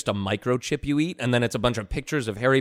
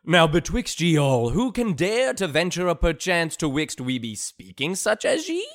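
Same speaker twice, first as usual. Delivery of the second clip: high, loud mock olde English; hammy stage actor; 7 semitones higher, 3 dB louder than usual. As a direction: play a pompous Shakespearean player. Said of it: "We were speaking dumb to remind you all about the olden times".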